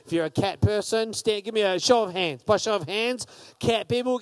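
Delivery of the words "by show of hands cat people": normal talking voice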